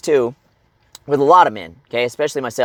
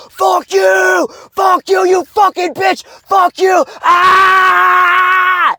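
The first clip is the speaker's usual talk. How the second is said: screaming at the top of the voice, high and loud; furious scream; 4 dB louder, much slower than usual. Shown as a demonstration. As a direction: scream your head off in a parked car. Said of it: "just fucking scream your head off".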